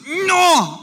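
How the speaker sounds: put-on loud, high, stretched toddler no; two-year-old refusal. Camper objecting to a hard mattress, loud toddler-like refusal. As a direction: wail out a mock childish refusal. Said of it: "our two-year-old".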